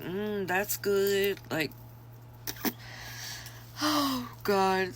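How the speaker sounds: slow, relishing murmur; drawn-out creepy satisfaction; savoring creepy voice. Retold or imitated. Customer savoring the pee.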